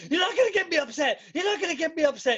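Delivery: high, loud, rapid mock outburst; angry shouting. Retelling a livid commentator yelling at his colleague, angry shouting. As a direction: imitate him losing his temper, fast and high. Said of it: "he's, like, so angry, shouting".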